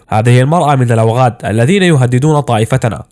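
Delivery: ordinary delivery, nothing marked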